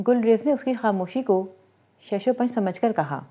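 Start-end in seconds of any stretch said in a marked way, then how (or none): none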